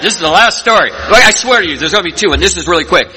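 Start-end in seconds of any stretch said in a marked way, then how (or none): 0.0-0.9 "This is the last story." said high and sing-song, stressing "last"; mock speaker cliché. Mocking every speaker who promises "last" story, high and teasing. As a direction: poke fun at the speaker's own line. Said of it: "Yeah, you always hear that".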